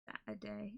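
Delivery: "Saturday" said very softly as "ætədeɪ", leading S dropped; Cornish Saturday. Shown as a demonstration. Streamer showing how she would say the day. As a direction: drop the S in "Saturday". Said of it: "Saturday is how I would do it".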